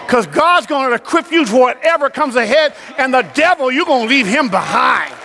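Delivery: high, driving preacher's voice; full-force preaching. Real, not acted. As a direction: preach it hard, pitched well above talk.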